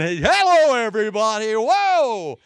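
high, loud, overeager greeting; bouncy seminar host. Retold or imitated. Seminar speaker bounding in on weary listeners, overeager greeting. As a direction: burst in like a peppy motivational speaker. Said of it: "want anyone to bound in and".

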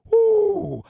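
high, loud mock-shocked ooh; ooh of surprise. Real, not acted. Reacting to the punchline.

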